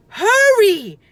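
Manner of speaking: very loud, high yell. A narrator's reading. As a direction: yell at Annie to hurry. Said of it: "Jack yelled".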